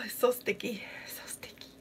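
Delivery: real whisper, fading away at the end. Real, not acted. Streamer whispering to herself about her sticky face. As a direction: whisper it close, disgusted at the stickiness.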